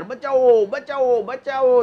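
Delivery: loud, high mock calls for help; hammed-up shouting for rescue; a con man pretending to drown, calling for help; fake cry for help.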